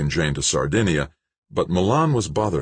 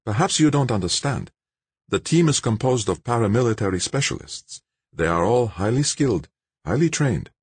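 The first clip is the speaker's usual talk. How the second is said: higher-pitched, careful character voice; formal, reasoning Nobo; 7 semitones higher than usual. A narrator's reading. Nobo refusing George a place on the team, formal reasoning.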